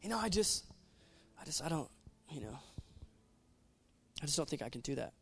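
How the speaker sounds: a soft, hesitant, mumbling doubter; mock timid believer. Preacher voicing a timid churchgoer, soft and hesitant. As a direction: shrink back like someone too scared to try. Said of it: "Somebody says something negative".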